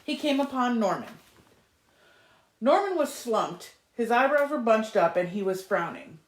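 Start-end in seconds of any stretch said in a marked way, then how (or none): none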